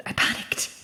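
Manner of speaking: breathless whisper, almost no voice; hushed, frightened confession; panicked whisper. Acted. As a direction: let the panic slip out as a whisper. Confessing the drowning in fog.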